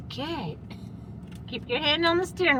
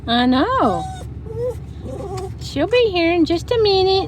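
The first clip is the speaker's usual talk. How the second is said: high pet voice; 7 semitones higher, 4 dB louder than usual. Real, not acted. To Finn the dog waiting for mom, loud high baby talk. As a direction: reassure the dog in a lifted voice.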